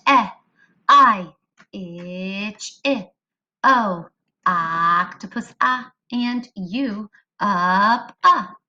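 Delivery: each short vowel sound stretched out slowly; teacher sounding out vowels; slow vowel drill. Shown as a demonstration. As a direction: model every vowel sound for the kids. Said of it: "warm up our vowel sounds".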